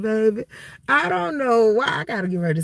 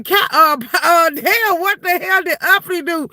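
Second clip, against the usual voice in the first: shocked exclaiming; 7 semitones higher, 4 dB louder than usual. Retold or imitated. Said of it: "I say".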